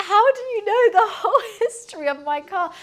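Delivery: half laughing, half baffled at how much she knows; laughing disbelief. Retold or imitated. Retold baffled reply, laughing.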